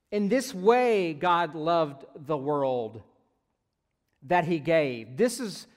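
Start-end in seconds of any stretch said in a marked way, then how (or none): 0.1-3.0 slow, stressed phrase with a louder start; a teacher spelling out the true meaning; emphatic slow. Shown as a demonstration. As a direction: lean on IN THIS WAY. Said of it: "what I came to understand".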